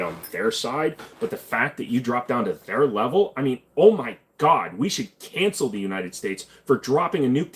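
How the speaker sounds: loud, high, exaggerated shocked outrage; over-the-top mock outrage; mock outraged critic. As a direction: ham up a scandalised critic gasping oh my God. Mocking an outraged woke critic.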